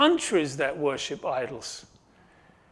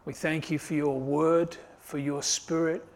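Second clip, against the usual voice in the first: closing prayer; 4 dB quieter than usual. Acted. Pastor leading the congregation in closing prayer, hushed.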